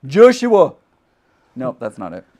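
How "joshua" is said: put-on loud, high yell in Scottish accent. Trying out how Josh gets yelled at, Scottish yell. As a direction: holler a name like a Scot. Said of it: "being yelled in a Scottish accent".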